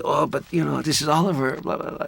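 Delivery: high, placating mock voice; mimicking Ramon calming the angry actor; soothing friend. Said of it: "And Ramon would say".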